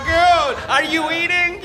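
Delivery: ordinary talk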